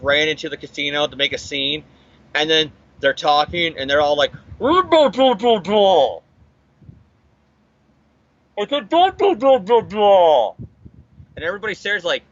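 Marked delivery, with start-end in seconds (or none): 4.6-10.5 loud gibberish blurred as if through cloth; mock masked shouting, words smothered into noise; muffled by a mask. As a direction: garble it loudly, high start sliding down. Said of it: "the voice is being muffled with the mask".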